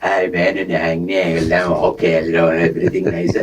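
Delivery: low voice, lightly acted elder relative; Malayali uncle. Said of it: "So one uncle came".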